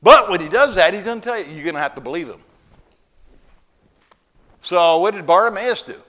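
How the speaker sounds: loud, rising preacher's call; preaching at full force. Acted. Preacher rousing the congregation to believe, full force. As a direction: drive it home to the congregation.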